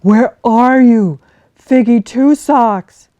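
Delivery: loud, slow call across the river; worried bear calling by the river. Acted. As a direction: shout to find her. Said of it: "and called again".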